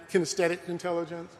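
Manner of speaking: usual speaking voice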